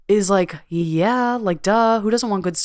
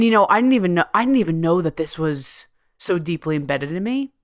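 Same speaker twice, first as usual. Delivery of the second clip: normal talking voice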